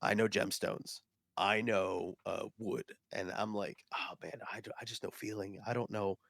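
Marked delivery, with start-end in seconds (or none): 3.9-6.2 soft, deflated aside; sheepish muttering. Retold or imitated. Non-expert facing wood and gemstone connoisseurs, sheepish and quiet. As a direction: admit it quietly, out of your depth.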